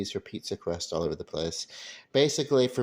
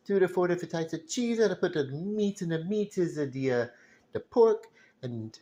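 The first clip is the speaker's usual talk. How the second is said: high-pitched hammed-up Italian accent; crappy Italian accent; 10 semitones higher, 3 dB louder than usual. Vlogger jokily narrating homemade pizza steps, crappy Italian accent. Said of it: "I do a crappy Italian accent".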